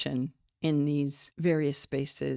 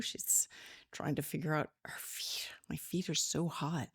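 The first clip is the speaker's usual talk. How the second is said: a soft whispered aside about hot feet; 7 dB quieter than usual. Real, not acted. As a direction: whisper it to yourself while fidgeting. Fussing with her hot feet.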